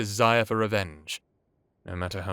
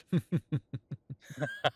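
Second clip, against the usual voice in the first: a light rising laugh at his own joke; chuckle, pleased with himself; soft laugh that lifts and fades; amused chuckle; 5 semitones higher, 5 dB quieter than usual. Acted.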